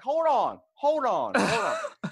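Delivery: loud, high-pitched calling out; stunned protest. Retold or imitated. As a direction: holler to halt someone walking off. Retelling his stunned protest to cousin.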